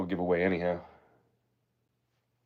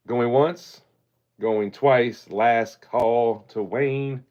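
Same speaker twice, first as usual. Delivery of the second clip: drawn-out auctioneer call, slow on the last lines; 3 semitones higher, much slower than usual. Acted. Closing the coin auction to Wayne, slow auctioneer call.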